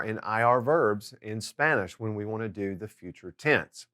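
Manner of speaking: ordinary delivery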